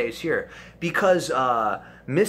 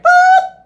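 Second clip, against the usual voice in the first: sharp high boop like a rewind button; sudden squeaky boop to halt him; loud boop; 16 dB louder than usual. Retold or imitated. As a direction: blurt a cartoon stop sound. Rewind noise mid-argument.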